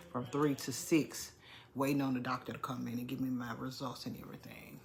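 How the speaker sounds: ordinary talk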